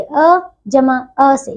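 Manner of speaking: slow, deliberate spelling out of a vowel sum; slow explaining. Shown as a demonstration. Teacher to her pupils, slowly spelling out vowel sums. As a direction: lay each sound out slowly for the children. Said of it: "teacher spells out vowel sum".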